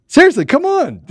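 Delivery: pleading. Real, not acted. Begging him to join.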